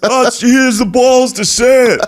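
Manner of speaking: high mock drunk fan's voice; drunk pub bloke. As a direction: ham up a drunk admirer in a bar. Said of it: "everyone would get drunk and be like".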